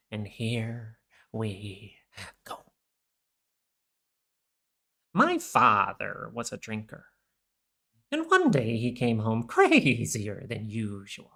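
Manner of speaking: soft, slow, menacing Joker impression. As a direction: linger on each word like a brooding Joker. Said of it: "gotta do the Joker voice".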